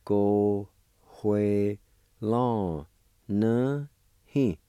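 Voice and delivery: Ko, hue, lon, ne, hin separated, rising toward hin; Cherokee syllable by syllable. Shown as a demonstration. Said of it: "it's pronounced like this".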